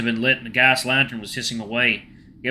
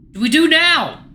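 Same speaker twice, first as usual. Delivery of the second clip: high, loud frantic yelling; panicked blaming shouts; screaming argument; 14 semitones higher, 6 dB louder than usual. Acted. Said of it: "screaming at each other".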